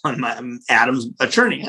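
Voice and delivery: ordinary talk, nothing marked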